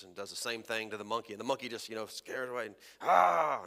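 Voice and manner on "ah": a short loud growling "Ah"; lion growl. Acted. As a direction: growl at the monkey. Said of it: "and he growls".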